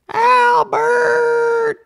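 very high, loud shouted name; wife shouting. A narrator's reading. Farmer's wife shouting for her husband, full yell. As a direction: bellow his name to get him out of bed. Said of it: "the farmer's wife yelled".

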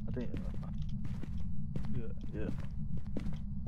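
hushed whispered answer; whispering. Real, not acted. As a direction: keep it under your breath. Sneaking through haunted house.

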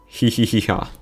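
tittering hee-hee laugh, trailing off softly; stifled giggle fading away; giggling. Acted. Audience member giggles at the hypnotist's speech, giggling.

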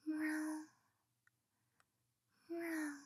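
playful high kitty meow; cat meow. Pretend. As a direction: try to sound like a real cat. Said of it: "You want it like a cat?".

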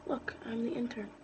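whispered secret aside. Real, not acted. Gamer whispering an aside to viewers, whisper. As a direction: whisper it like a confession.

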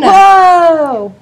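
high loud exclamation; real surprise. Real, not acted. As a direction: gasp out in amazement. Child reacts to lion.

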